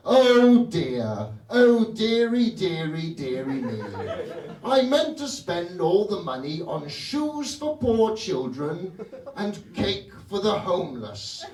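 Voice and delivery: put-on fussy upper-class British accent, slow and dithering; posh British Obama. Obama fretting in the Oval Office in a posh British accent. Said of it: "why are you speaking with a British accent".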